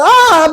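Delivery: sharp high yelp of pain; a short high pained cry; retold yell of pain. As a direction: re-enact crying out when the sore neck is pressed. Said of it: "Sabi kong gano'n".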